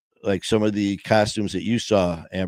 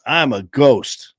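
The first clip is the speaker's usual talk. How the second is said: put-on little boy in joke; 4 semitones higher, 7 dB louder, slower than usual. Kid's voice in joke.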